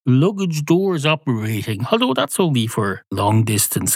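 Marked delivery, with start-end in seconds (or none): 0.1-1.8 high beeping, announcement voice; kid's bus announcement. Pretend. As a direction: play the bus, beep beep. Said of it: "imaginative kids will at least go".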